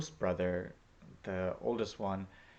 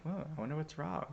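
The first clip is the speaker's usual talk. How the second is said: high, soft mock puzzlement; oblivious wondering; 8 semitones higher, 5 dB quieter than usual. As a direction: wonder aloud without a clue. Acts out own oblivious wondering.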